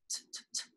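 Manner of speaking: T as three soft voiceless taps; repeated letter sound. Shown as a demonstration. Teacher tapping out a syllable, bare T sounds, very soft. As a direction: puff out "t, t, t" very softly. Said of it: "Tap it".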